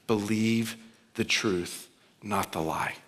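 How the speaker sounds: Believe the truth drawn out, not the lie dropping low; slow emphatic refrain. Shown as a demonstration. Preacher driving home the refrain to the congregation, slow and emphatic. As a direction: lean on believe, let lie fall away. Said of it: "repeated refrain, slowed".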